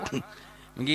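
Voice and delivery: normal talking voice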